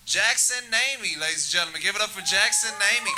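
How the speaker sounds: hyping up the crowd; loud host call to the stage; host bringing the next comic up to the mic, announcing loudly; announcer. Acted.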